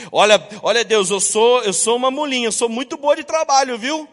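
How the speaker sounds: high, loud bragging to God; mock believer boasting of bookings, high and loud; boastful servant. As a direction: show off your worth eagerly. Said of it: "pra sermos uma mula".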